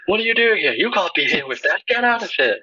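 high, squeaky ref telling someone off; scolding referee voice. Retold or imitated. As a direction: yell like a fussy tiny referee.

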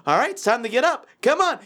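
perky parental rousing; chipper morning dad. Retold or imitated. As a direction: imitate an annoyingly chipper father. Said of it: "it's that chipper person".

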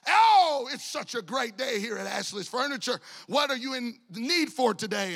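a light imitation of a chirpy salesman, big high "Oh"; eager salesman. Old salesman pouncing on a couple walking in, lightly voiced eager pitch. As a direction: greet them like an overeager salesman.

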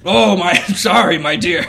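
loud, high minotaur voice with a laugh; Gary. Acted. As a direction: apologise heartily as a big friendly beast. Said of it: "Gary the Minotaur".

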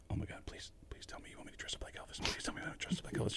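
hushed, rapid begging; whispered plea. Retold or imitated. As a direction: pray for it under your breath. Secret inner plea while listening.

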